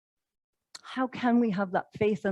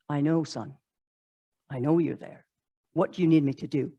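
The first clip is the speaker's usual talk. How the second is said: lower, calm voice of God; 7 semitones lower, 3 dB quieter than usual. Acted. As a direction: answer gently like a fatherly God. God's voice answering climber.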